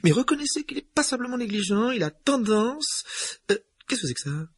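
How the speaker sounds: put-on high, tense older woman; McGonagall voice. A narrator's reading.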